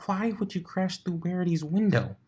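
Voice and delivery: high, rushed unicorn voice firing questions; a bookish mare, rattled and demanding answers; flustered Twilight. Acted.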